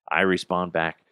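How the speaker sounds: normal talking voice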